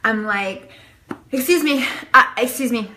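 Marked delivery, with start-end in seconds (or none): 1.3-2.9 higher, louder urgent plea; frantic interrupting. Retold or imitated. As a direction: burst in demanding attention. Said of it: "this is how I was".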